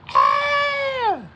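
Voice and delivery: sudden high yelp; startled high squeak; mock scream. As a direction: squeal out a quick startled cry. Mimicking the explosion moment.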